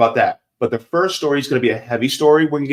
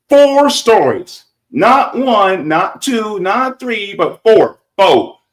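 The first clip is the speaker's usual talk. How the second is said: high, loud, hyped countdown; hype announcer; 10 semitones higher, 6 dB louder, much slower than usual. Acted. Host hyping four stories for chat, loud and excited. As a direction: sell it like a big reveal.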